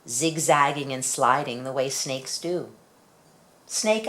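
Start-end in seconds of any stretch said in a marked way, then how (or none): 3.7-4.1 calling out. Acted. Child calls to snake.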